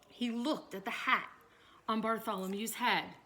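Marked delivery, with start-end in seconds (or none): none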